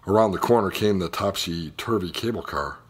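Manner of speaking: ordinary delivery, nothing marked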